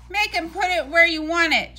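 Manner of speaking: rally the batter; team shout. A narrator's reading. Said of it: "shouted the rest of the team".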